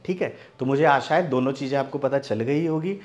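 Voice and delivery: ordinary delivery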